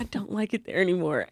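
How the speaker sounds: hushed whisper. Acted. Whispering to her friend about work. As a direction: whisper it like a secret.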